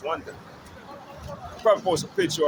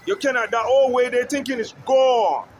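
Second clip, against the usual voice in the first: loud, raised rant; shouting; 4 semitones higher, 4 dB louder than usual. Real, not acted. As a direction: shout it out, fed up. Ranting at the government.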